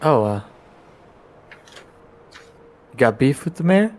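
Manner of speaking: ordinary talk, nothing marked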